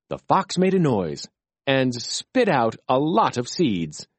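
usual speaking voice

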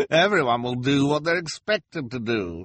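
high, loud knowing menace; reply as if amused by their fate; chuckling reply. Acted. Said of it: "replied with a chuckle".

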